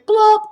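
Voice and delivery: very high loud popping plop; sound-effect plop. Shown as a demonstration. Teacher leading the children in a loud high plop. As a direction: pop it like a stone in water. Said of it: "we're all going to say".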